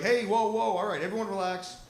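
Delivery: calling for calm. Retold or imitated. Shout the set back to calm. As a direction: director to a spooked film crew, loud calming call. Director calming a spooked set.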